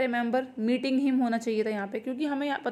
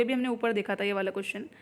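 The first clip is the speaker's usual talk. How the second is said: usual speaking voice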